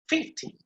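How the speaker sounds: fifty with FIF pitched high; stress on FIF. Shown as a demonstration. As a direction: hit the first syllable of fifty. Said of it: "The stress is placed on the first syllable".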